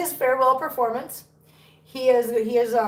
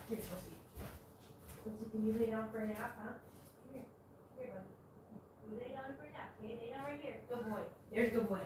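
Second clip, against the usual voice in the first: hushed, soft coaxing whisper; quiet murmur to a sleepy kitten; 20 dB quieter than usual. Real, not acted. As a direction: whisper so the kitten stays asleep.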